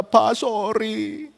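slow mock-tearful apology; imitated tearful apology. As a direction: act out a weepy child's sorry. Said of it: "Dia berurai air mata".

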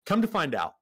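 ordinary talk, nothing marked

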